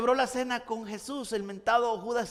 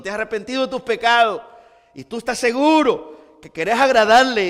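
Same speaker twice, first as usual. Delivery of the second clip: rising, louder preacher's call to repentance; fervent preaching; 4 semitones higher, 7 dB louder than usual. Acted. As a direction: preach it with full conviction. (predicando con fervor y más fuerte)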